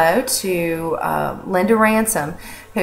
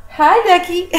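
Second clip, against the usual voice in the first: much higher sing-song hello to a pet; pet talk; 12 semitones higher than usual. Real, not acted. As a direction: coo a greeting to a bunny. Greeting her pet on camera.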